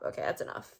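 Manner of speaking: muttered aside to herself; whispered self-hush. Real, not acted. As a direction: whisper "that's enough" to yourself, breathy and unvoiced. Cutting herself off.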